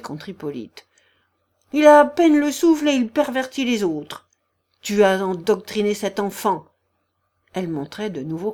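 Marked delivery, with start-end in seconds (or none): 1.7-6.7 loud accusation, starting high; indignant ranting woman. A narrator's reading. The general's wife blaming Hippolyte, indignant. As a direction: hurl the blame at the invalid.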